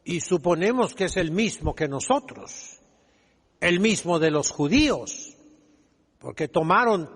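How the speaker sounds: ordinary talk, nothing marked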